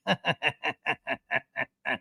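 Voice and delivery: mock cackle, pulsed out at length; long staccato put-on laugh; imitated laughter. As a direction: mimic someone laughing meanly. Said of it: "she's just laughing".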